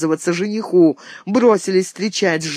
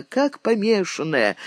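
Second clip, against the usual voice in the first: ordinary delivery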